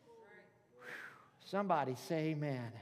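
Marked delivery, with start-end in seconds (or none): none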